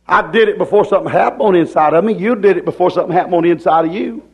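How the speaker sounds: high-pitched, emphatic sermon; full-force preaching. Real, not acted. Preacher telling folks something happened inside, full-force preaching. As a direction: drive it home to the congregation.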